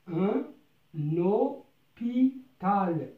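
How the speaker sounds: "اوبيتال" stretched out slowly, syllable by syllable; slow teaching read. Shown as a demonstration. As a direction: draw out "اوبيتال" so learners catch each sound. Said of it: "نقرأ هاد الكلمات".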